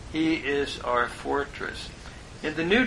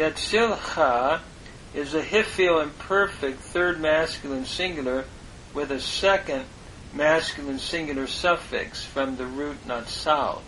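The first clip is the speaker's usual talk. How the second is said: usual speaking voice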